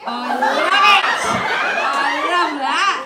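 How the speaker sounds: loud, laughing whoop back; excited yell. Real, not acted. Comic answering a cheering crowd, excited yell.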